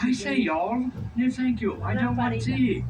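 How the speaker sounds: lightly hammed British with Arkansas slips; faked British accent. Guide mocks tourist from Arkansas refusing tea, faked British accent. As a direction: badly fake a Brit from Arkansas. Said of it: "he's faking that accent".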